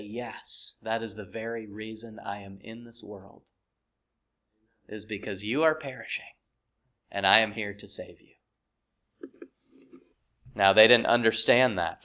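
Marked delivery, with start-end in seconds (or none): 0.0-8.3 very soft, low and hushed; hushed, low-pitched reassurance; gentle reply. Acted. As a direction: answer softly, low, as a saviour would. Voicing Jesus answering the disciples.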